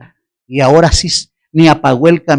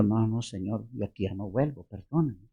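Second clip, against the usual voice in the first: hushed, timid begging; frightened plea; 9 semitones lower, 19 dB quieter than usual. Retold or imitated. Scared preacher pleading softly with the machete man. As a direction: shrink back and beg him quietly.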